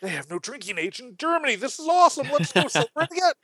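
fast, high giddy cheer; mock party tourist. Tourist mocked as giddy about drinking. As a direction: sound thrilled to go drinking.

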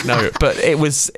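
normal talking voice

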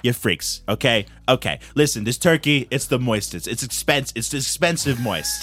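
mock-presidential bragging, sliding toward Brooklyn; Donald Trump. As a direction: ramble in repeated superlatives. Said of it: "it's Donald Trump".

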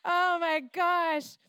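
high, drawn-out squeal of alarm; mock alarm. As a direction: let the pores shriek in panic. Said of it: "my Canadian pores are like".

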